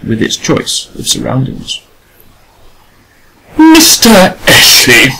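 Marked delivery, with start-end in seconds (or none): 3.6-5.1 high, slow, trembling call of his name; a lady's voice shaking as she calls him. A narrator's reading. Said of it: "said Adela in a shaking voice".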